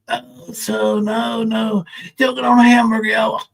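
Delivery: drawn-out, slurred mimicry; mock slurring. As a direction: slur it slowly to mock him. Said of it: "Are you gonna slur when you say it?".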